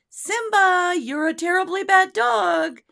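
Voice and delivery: high, loud, cheerful praise voice; happy tone for bad words. Shown as a demonstration. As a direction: scold with a sweet happy tone. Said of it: "they hear the tone".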